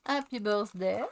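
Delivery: English words said with a thick French accent; jokey Frenchified English. Shown as a demonstration. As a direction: say "happy birthday" the French way, no h. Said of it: "Vous avez vu un peu mon accent, euh, à la française".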